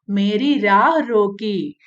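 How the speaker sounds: normal talking voice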